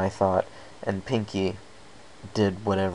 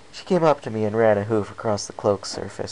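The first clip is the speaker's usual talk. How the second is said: ordinary delivery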